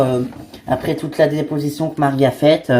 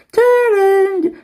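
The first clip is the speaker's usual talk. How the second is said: doorbell sound; 3 dB louder than usual. Acted. Doorbell imitated.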